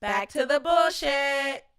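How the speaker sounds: slow, high, loud call-out; announcing. Real, not acted. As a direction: proclaim the segment change. Announcing segment switch.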